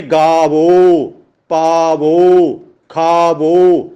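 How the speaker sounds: ਗਾਵੋ, ਪਾਵੋ, ਖਾਵੋ said very slowly, final "o" held long; drawn-out final O. Shown as a demonstration. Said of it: "ਹੋੜੇ ਨਾਲ ਬੋਲੇ ਜਾਣਗੇ". (ਗਾਵੋ, ਪਾਵੋ, ਖਾਵੋ ਦਾ ਹੋੜਾ ਲਮਕਾ ਕੇ ਬੋਲਿਆ)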